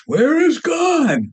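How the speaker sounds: high, drawn-out booming call; booming minister. Acted. Minister demands an answer from the boy, booming. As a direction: boom it out slowly like a preacher. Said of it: "in a little more booming voice".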